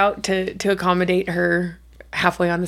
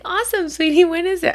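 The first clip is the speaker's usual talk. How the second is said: much higher, sugary supportive mom; sweet mom voice; 10 semitones higher than usual. Retold or imitated. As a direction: coo it up high to your kid. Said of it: "I was like".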